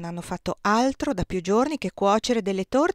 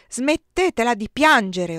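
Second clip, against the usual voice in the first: put-on a slow, loud roar at the crying girl; 3 semitones higher, 7 dB louder, slower than usual. A narrator's reading. Colonel roars at the weeping girl, loud and slow. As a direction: bellow the order, slowly. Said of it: "Ruggì".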